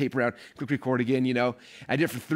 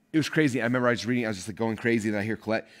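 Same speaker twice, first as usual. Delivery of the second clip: usual speaking voice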